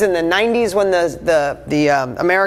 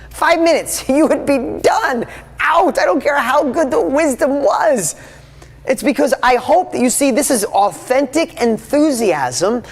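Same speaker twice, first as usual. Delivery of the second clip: much higher, fired-up voice with a laugh; excited teasing; 8 semitones higher than usual. Real, not acted. Speaker teases viewers who'd leave after five minutes, much higher. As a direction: tease them, pitch jumping way up. Teasing audience about leaving.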